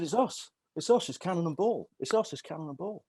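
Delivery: hammed-up comic whine; pleading comedian. Bobby Ball insisting to the guard who they are, squeaky and pleading. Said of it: "And he said".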